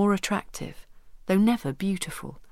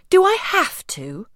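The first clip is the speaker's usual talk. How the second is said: much higher, louder, slow whine; whining daughter; 11 semitones higher, 7 dB louder than usual. Acted. Belinda begging her mother, high and whiny. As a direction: drag it out like a sulky child.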